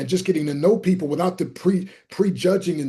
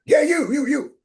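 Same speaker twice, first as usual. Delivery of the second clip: high, louder eager pointing out; single him out with glee; eager greeter insisting; excited insistence; 10 semitones higher, 4 dB louder than usual. Retold or imitated.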